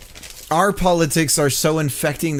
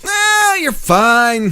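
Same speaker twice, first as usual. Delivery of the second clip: dismissive doctor; 9 semitones higher, 4 dB louder, much slower than usual. Retold or imitated. Doctor brushing off patient.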